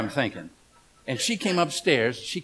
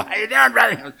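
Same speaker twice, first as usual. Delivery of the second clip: higher, louder hearty hello; hearty greeting; 7 semitones higher, 8 dB louder than usual. Retold or imitated. Preacher showing how he greets men, hearty and loud. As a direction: greet a brother with a firm handshake, booming. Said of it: "I usually go".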